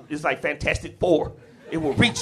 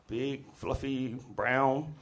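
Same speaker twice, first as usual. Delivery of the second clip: slow, much softer, flat-out unimpressed; deadpan; 3 semitones lower, 8 dB quieter, much slower than usual. Retold or imitated. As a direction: drop each word slowly and dryly. Sister's deadpan verdict.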